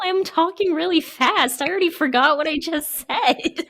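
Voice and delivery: high-pitched, laughing self-defence; flustered and giggly, voice riding high. Real, not acted. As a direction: protest through laughter, pitch jumping up. Teased about a slip, laughing.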